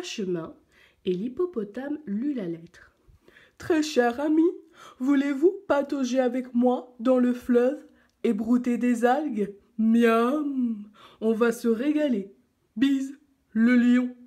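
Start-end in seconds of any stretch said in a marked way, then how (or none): 3.6-14.2 put-on hippo voice, slightly loud; hippopotamus reading aloud. A narrator's reading. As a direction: read it out as a hearty hippo. Hippo reads his letter aloud. (hippopotame qui lit, voix appuyée)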